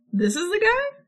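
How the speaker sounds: pitched well up, incredulous mock question; high mock question. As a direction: squeak it out in disbelief. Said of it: "And then Gower's like".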